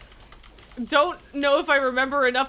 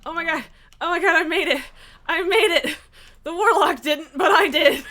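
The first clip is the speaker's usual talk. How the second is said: relieved laughter; 6 semitones higher, 8 dB louder than usual. Real, not acted. Survived the dungeon mechanic.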